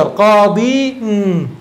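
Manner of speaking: long ii ending on قاضي, followed by a held nn; two sukuns meeting. Shown as a demonstration. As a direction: stretch قاضي as qaa-diii, then hum a separate n. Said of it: "التقت الياء الساكن مع النون".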